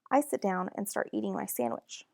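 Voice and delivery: plain reading voice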